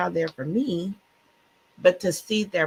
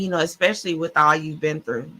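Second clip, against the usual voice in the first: normal talking voice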